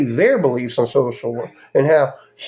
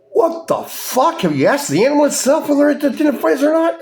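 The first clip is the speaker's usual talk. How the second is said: incredulous; 12 semitones higher than usual. Real, not acted. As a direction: pitch it way up in disbelief. Incredulous outburst in rant.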